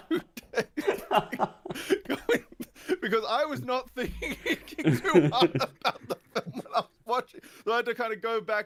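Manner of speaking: high-pitched talking through laughter; laughing. Real, not acted. Storyteller admitting the film went unnoticed, laughing hard. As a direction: crack up while finishing the sentence.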